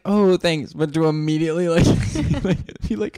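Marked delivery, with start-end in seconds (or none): 0.0-0.7 higher, a touch louder fake thanks; mock polite thanks with an edge; passive aggressive. Acting a homeowner's passive aggressive thanks. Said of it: "passive like".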